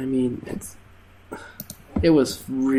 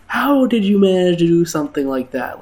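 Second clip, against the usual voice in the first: mock angry manager, a bit higher and louder; a hammed-up scolding boss voice; imitating the manager; 6 semitones higher, 7 dB louder than usual. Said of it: "he's like".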